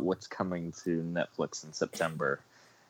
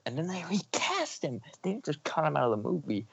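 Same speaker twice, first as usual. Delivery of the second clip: raised, incredulous rant; indignant; 8 semitones higher than usual. Real, not acted. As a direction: pitch it up in disbelief.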